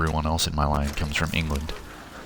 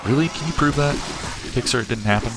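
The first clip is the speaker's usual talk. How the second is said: skeptical challenge; 9 semitones higher than usual. Retold or imitated. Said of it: "There's probably nothing I say more than".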